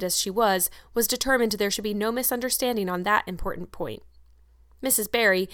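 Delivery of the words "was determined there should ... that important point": plain reading voice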